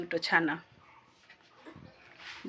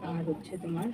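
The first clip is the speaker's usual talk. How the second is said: usual speaking voice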